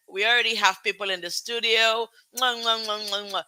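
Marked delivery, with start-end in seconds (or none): 2.4-3.4 quick smacking air kisses to the audience; blown kisses. Real, not acted. Host blowing kisses to people in the studio, smacking air kisses. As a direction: blow kisses down the line.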